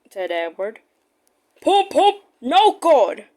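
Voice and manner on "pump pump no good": higher, louder grumble; grumbling. Acted. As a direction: complain as you strain. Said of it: "grumbled Gordon".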